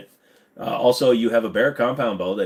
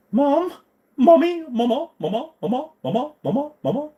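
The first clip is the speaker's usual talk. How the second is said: put-on child calling mom; 14 semitones higher than usual.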